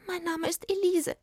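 high, timid fairy voice; small, anxious fairy; frightened little fairy. A narrator's reading. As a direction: answer in a tiny, high fairy voice. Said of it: "die vor Angst zitterte".

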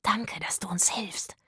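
hushed, grateful whisper. A narrator's reading. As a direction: whisper it so nobody else hears. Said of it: "flüsterte Zora".

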